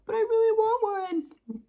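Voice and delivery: mock whining daughter; whiny kid. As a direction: whine it like a disappointed little girl. Child whining voice.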